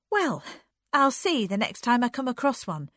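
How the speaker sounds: high, loud, defiant; defiant promise. A narrator's reading. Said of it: "she promised defiantly".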